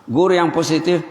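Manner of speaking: normal talking voice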